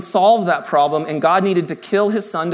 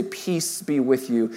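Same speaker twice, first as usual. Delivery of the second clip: soft peace; 8 semitones lower, 8 dB quieter, slower than usual. Acted. God's words quoted softly.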